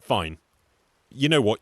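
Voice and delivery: ordinary delivery, nothing marked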